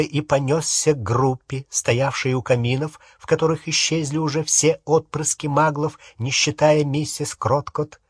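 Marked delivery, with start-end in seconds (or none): none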